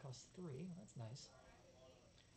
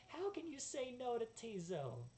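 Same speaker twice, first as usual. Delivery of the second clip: high, slow, drawn-out plea; high creature voice; 9 semitones higher, 4 dB louder, slower than usual. Acted. On behalf of tiny Tizo, high-voiced pleading.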